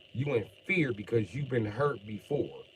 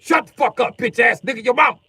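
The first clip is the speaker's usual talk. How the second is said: high, loud yelled insult; mock angry yell; 11 semitones higher, 11 dB louder than usual. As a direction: fake rage, yelled out. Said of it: "That don't mean I'm angry".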